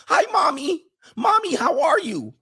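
put-on very high, childish greeting; mock mommy's boy. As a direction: coo to mommy like a little kid. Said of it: "Do you call her mommy".

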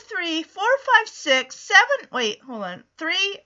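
ordinary talk, nothing marked

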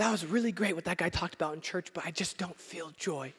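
a quiet, sinking admission; heavy-hearted. Pretend. Churchgoer waking up to a heavy heart, softly sad.

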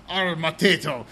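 a mildly growled pirate "Arr, Mateo"; growly pirate accent. Retold or imitated. Retelling Ralph's pirate catchphrase to a co-host, mild growly pirate accent. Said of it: "the growliest pirate accent I could come up with".

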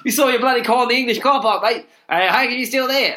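loud, high mock Aussie sliding into English; botched Australian accent. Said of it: "That's a bloody English accent".

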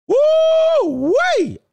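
high, loud falsetto woo-wee; excited whoop. Real, not acted. Reacts loudly to pictures.

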